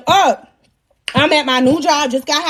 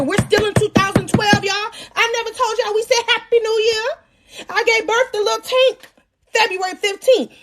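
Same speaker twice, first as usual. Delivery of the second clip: incredulous protest; 6 semitones higher than usual. Retold or imitated. Protests the doctor's shock news.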